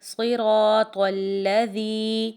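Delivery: صراط الذی said slowly, with the ی held long; long madd. Shown as a demonstration. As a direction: stretch the ی in الذی. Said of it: "اس کو ہم لمبا کریں گے". (الذی کی یا کو لمبا کر کے)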